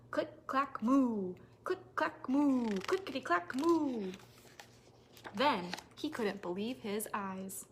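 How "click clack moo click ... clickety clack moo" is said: rhythmic typing chant. A narrator's reading. Cows typing sound chant.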